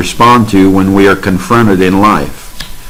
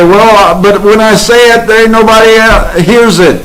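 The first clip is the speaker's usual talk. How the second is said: high, fast, put-on voice of a doubter; mocking quote; 10 semitones higher than usual. High, quick mocking voice of a doubter who thinks nobody hears. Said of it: "You say".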